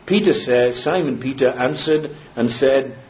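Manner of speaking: ordinary talk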